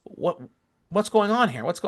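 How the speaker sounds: imitating her surprised challenge; indignant question. Lady confronts relative at dinner, mock indignant. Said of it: "she's like".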